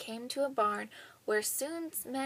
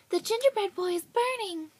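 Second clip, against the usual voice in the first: alarmed cry; 10 semitones higher, 8 dB louder than usual. Acted. Old woman discovers burning.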